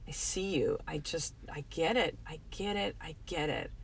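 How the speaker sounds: consoling words fading to near silence; soft reassuring. Real, not acted. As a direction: hush down to soothe the listener. Comforting a hurting listener.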